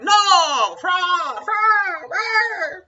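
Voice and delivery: very high, loud repeated shrieks; mock screaming. Trump shrieking fraud over and over, hammed-up scream. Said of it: "mock Trump yelling fraud".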